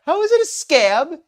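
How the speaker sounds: loud, high-pitched mock of a smug line; smug, mocking imitation. Host mimics a scammer's excuse, smug and mocking. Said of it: "This line is so darn smug".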